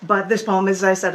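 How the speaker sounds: ordinary delivery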